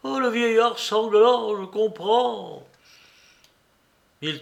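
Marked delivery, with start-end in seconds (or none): 0.0-2.7 higher, outraged cry, slowing on "je comprends"; accused man cries out in fury; beside himself. A narrator's reading. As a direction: rage turning to grim realisation. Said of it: "s'écria Mitya hors de lui".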